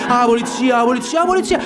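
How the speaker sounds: rising shout of ah polizia; crowd shouting. Acted. Crowd of tenants chanting at the police, rising shouts. Crowd voices following the inspector.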